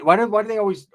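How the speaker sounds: ordinary talk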